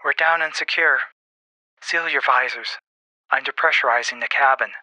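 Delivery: pilot over radio. Acted. Lander pilot announces touchdown to her crew, radio voice. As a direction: report procedure over the intercom.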